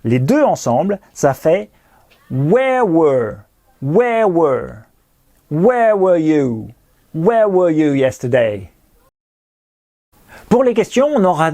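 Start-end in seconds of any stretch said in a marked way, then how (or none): 2.3-8.7 where and were both said "wear". Shown as a demonstration. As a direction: drill where were with the same vowel. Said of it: "mais ça se dit were".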